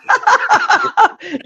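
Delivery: shrieking burst of laughter; high laugh. Real, not acted. Host reacting to friend's confession, high shrieking laugh. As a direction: crack up at the confession.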